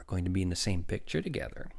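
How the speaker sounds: a touch of shyness, softening; shy. A narrator's reading. Woman tells the doctor about the picture, shy. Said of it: "she said shyly".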